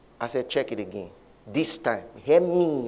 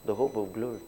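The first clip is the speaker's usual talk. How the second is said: much softer, lower murmured prayer; speaking inside; 5 semitones lower, 9 dB quieter than usual. Retold or imitated. Preacher retelling his silent confession at the clinic, very softly. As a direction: say it under your breath to yourself. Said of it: "I began to speak inside".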